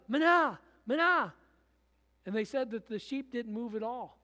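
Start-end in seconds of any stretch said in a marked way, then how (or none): 0.1-1.3 high, loud yell of a stranger; loud clumsy imitation of the shepherd's call; tourist yelling. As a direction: shout the call too hard, like an outsider trying. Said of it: "started yelling".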